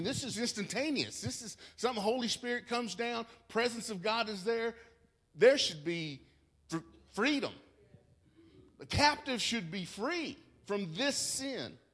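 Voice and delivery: preacher rising to the climax about freedom, loud; building preaching. Acted. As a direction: lift it to a climax.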